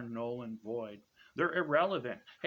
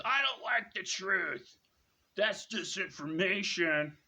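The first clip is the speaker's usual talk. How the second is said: higher, whiny mock voice of a truth-denier; 4 semitones higher than usual. Mocking TikTok moderators who reject the truth, whiny higher voice. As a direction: sneer it like a sulky know-nothing.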